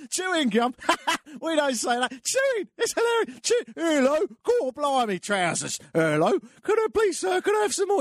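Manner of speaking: high, hammed-up Cockney, mocking an English accent; high-pitched, over-the-top Cockney mimicry; mocking Cockney imitation. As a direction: lay on a cartoon Cockney voice to tease. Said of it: "And they'll say".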